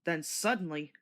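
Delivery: ordinary delivery, nothing marked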